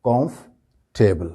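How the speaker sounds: "کمفرٹیبل" as slow, split "comf-table"; correct pronunciation. Shown as a demonstration. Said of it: "بولتے وقت یہ لفظ جو ہو جائے گا". ("کمفرٹیبل" کو "کامف ٹیبل"، ٹھہر کر)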